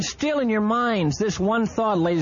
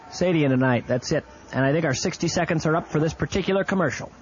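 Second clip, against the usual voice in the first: normal talking voice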